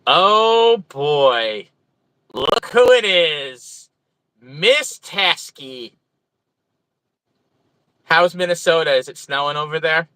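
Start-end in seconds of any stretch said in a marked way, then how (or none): 0.1-5.9 long, drawn-out words with swooping pitch; playful surprised greeting. Real, not acted. Streamer spotting oldest friend in chat, playful drawn-out greeting. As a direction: stretch it out as a delighted reveal.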